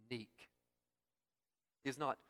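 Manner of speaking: normal talking voice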